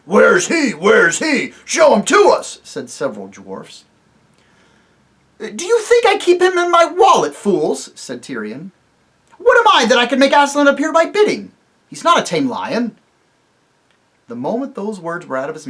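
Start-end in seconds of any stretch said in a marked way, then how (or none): 0.1-2.6 higher, loud taunting dwarfs; jeering dwarfs. Acted. The dwarfs jeer at Tirian, loud and taunting. 5.4-13.0 much higher, loud scorn; furious king. Acted. Tirian scolds the dwarfs, loud and furious.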